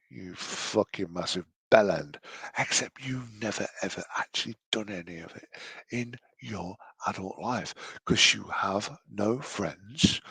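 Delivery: ordinary delivery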